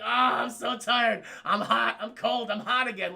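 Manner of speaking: loud, drawn-out whine at the start; imitating an actor's weary complaints; mock complaining. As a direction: play up the fidgety grumbling. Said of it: "Complaining like".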